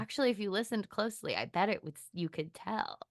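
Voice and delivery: hushed, matter-of-fact comeback to the casting people; quiet retort. Retold or imitated. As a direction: ease the volume down as you set them straight.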